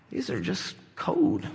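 low, hushed, slow line; acted quiet awe, pausing before the last word; calm realization. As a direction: say it softly with unhurried certainty. Neo calmly facing bullets, acted.

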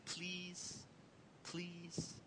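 hushed, very soft single word; gentle teaching voice. Retold or imitated. Parent teaching baby sign language, soft hushed prompting. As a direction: softly coax a baby to copy the word.

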